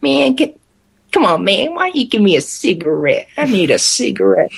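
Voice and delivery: mock high-pitched old man; high-pitched pleading. Old guy begging his companion for a cigarette, high-pitched. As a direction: lightly imitate a wheedling old guy, starting high. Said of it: "real high-pitched voice".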